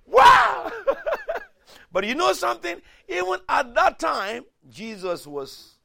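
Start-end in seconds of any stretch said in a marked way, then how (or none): 0.1-0.8 loud, high exclaimed wow with laugh; excited wow. Real, not acted. Preacher reacting to the crowd, loud and delighted. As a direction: burst out delighted.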